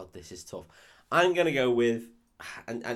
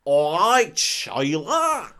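loud, high, hammed-up Australian accent; bad Australian accent; 6 semitones higher, 7 dB louder, much slower than usual. Podcaster mimicking Tarantino's cameo, badly faked Australian accent. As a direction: do a broad, terrible Australian accent. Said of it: "give himself an Australian accent".

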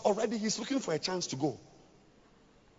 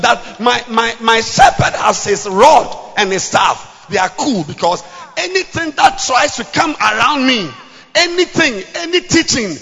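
much louder, higher shouted preaching; preaching at full force; 6 semitones higher, 16 dB louder than usual. Acted. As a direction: thunder it out to the congregation. Climax of sermon, shouting.

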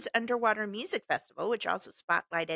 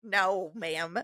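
ordinary talk, nothing marked

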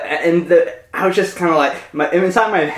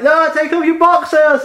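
mock demanding shout; 11 semitones higher than usual. Audience girls demanding, shouting.

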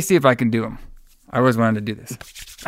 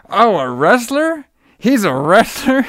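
mock surprised wrestler; 8 semitones higher than usual. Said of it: "She's like".

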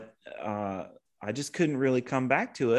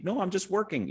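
high mock actor voice; 10 semitones higher than usual. Quoting actor's excuse.

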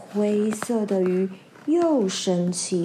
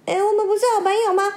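high and fast, rushing out; anxious plea; 11 semitones higher, 6 dB louder, much faster than usual. Acted. Red fish pleading with friends, rushed high voice. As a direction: blurt it high in a panic.

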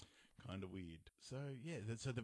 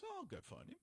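high silly voice; 4 dB quieter than usual. Retold or imitated. Dim clown volunteers.

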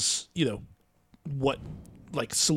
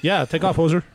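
put-on Canadian accent; 2 semitones higher, 3 dB louder than usual. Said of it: "sorry to my friends up north".

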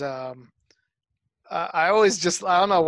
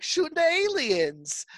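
put-on little kid voice; 10 semitones higher, slower than usual. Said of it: "And he's like".

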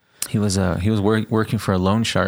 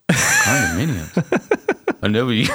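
stretched out, much higher, baffled Rocky; hammed-up Rocky; 5 semitones higher, slower than usual. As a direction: drag out the word Condominiums slowly in a silly Rocky voice. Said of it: "he's so like".

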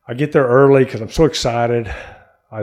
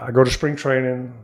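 ordinary talk, nothing marked

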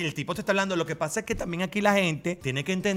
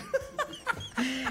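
high laugh; 6 dB quieter than usual. Real, not acted. Laugh after grading joke.

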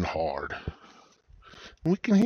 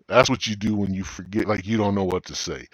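normal talking voice

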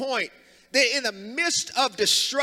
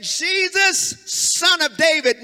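calling out; 4 semitones higher, 5 dB louder, slower than usual. Retold or imitated. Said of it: "He said".

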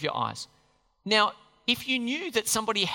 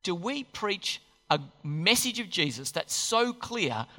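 usual speaking voice

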